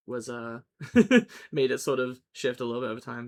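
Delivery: normal talking voice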